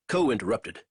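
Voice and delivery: ordinary delivery, nothing marked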